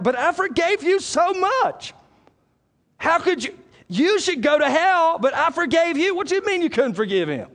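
high, impassioned voice of an incredulous Lord; preacher voicing a wounded, indignant Lord; indignant Lord rebuking. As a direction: act out God's astonished rebuke. Acts the Lord rebuking unforgiving believer.

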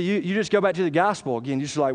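usual speaking voice

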